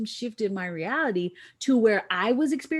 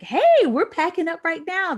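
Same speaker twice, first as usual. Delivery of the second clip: cheerful team; 9 semitones higher, 8 dB louder than usual. Retold or imitated. Canadian team answering the phone.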